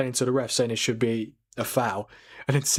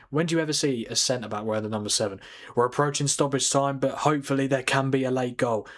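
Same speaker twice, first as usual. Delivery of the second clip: ordinary talk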